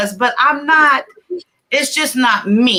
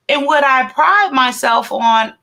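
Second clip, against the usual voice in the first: ordinary talk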